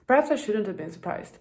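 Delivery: ordinary talk, nothing marked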